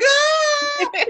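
high excited shriek; joyful scream. Retold or imitated. Winner screaming in the street, high joyful shriek. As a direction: scream of disbelief. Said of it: "I was like".

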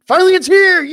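rising, loud hype shout; excited shout. Real, not acted. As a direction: yell it out in triumph. Excited announcement of game night.

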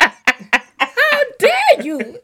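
high, slow shrieking Karen; entitled Karen voice. Pretend. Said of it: "full Caucasian woman".

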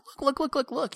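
urgently grab someone's attention, voice jumping up high; freaking out. Retold or imitated. Dad getting his son's attention in the cinema, imitated freak-out. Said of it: "I'm freaking out, I'm like".

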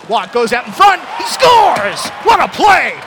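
roaring goal call, high and loud. Real, not acted. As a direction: belt out the goal.

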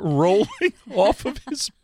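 telling it through real laughter, high pitched; cracking up mid-story, voice riding high; crying with laughter. Real, not acted. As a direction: laugh helplessly while trying to finish the story. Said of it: "I'm crying".

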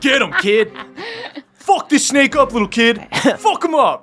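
rowdy cheering. Acted. Wolf NPC cheers on the kid.

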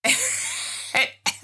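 high, airless mock giggle; a mocking girly titter; breathy giggle. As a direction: giggle like the smitten girlfriend. Mocking the girlfriend's gushing.

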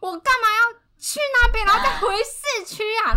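high, loud exasperated snap; irritated retort. Retold or imitated. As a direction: throw the question back in outrage. Partner angry at wrong station.

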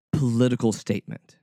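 normal talking voice

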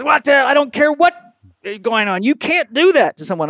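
a milder echo of the doctor's fury; angry doctor. Retold or imitated. Surgeon insisting you can't do that, imitated anger. Surgeon still yelling on phone.

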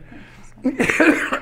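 harsh throat-clearing cough. Real, not acted. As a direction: cough hard into the mic. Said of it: "This is more than a cough".

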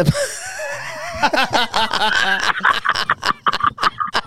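high, shouted burst of laughter. Real, not acted. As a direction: roar with real laughter. Laughing at his own joke.

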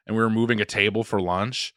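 usual speaking voice

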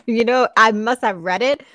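ordinary delivery

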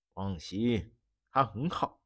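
soft, low, subdued answer; defeated quiet. Acted. Baron answering from the floor, soft and defeated. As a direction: give in quietly, no fight left. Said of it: "毫无反抗之力".

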